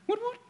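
high siren chirp imitated; mock police siren woop woop; police siren whoop. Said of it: "you know that sound".

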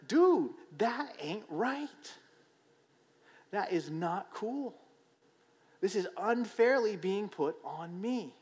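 high, slow, whiny protest; indignant inner voice. Retold or imitated. To the boss, high slow aggrieved whine. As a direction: drag it out like a wronged kid. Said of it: "it's gonna be like".

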